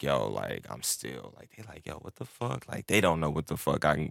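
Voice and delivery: ordinary talk, nothing marked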